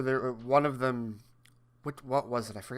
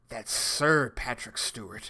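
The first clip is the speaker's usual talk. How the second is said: slow, lower, stately mock correction; imitating Patrick Stewart; 4 semitones lower, slower than usual. Imitating Stewart correcting Hamill in the commercial, slow and stately. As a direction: draw out SIR, imitating the actor. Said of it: "And he's like".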